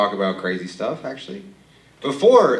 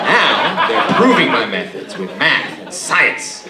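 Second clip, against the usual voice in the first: slow, loud, laughing through it; laughing grandpa; 4 semitones higher, 8 dB louder, much slower than usual. Retold or imitated. Demented grandpa to grandson, slow loud laughing boast. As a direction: chuckle out the old man's boast.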